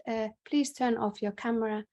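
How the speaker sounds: ordinary talk, nothing marked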